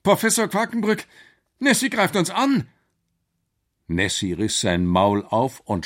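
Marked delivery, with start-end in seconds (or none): 0.0-2.7 high, urgent cry; frightened boy calling out; panicked warning. Acted. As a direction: warn the professor in alarm, pitched way up. Boy warns of monster attack.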